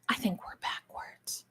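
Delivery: breathy whispered aside. Real, not acted. Streamer whispering to herself about the camera, whispered. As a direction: drop to a whisper, checking to herself.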